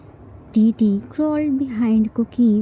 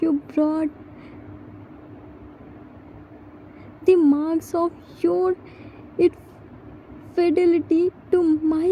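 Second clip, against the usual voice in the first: a lightly voiced wounded husband-wife reproach, raised pitch; hurt wife's accusation; 7 semitones higher than usual. Husband confronted by betrayed partner, wounded and pleading.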